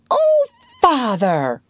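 high, loud, drawn-out reproach; shocked exclamation. A narrator's reading. Wendy seeing father hide his medicine, shocked exclamation. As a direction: cry out in dismay. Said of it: "Wendy exclaimed".

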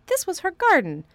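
lightly voiced boy in wonder. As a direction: wonder at it aloud. Colin moved by mother.

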